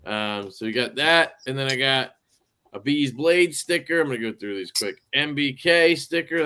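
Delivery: ordinary delivery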